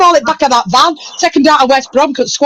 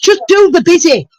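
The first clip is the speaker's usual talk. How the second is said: normal talking voice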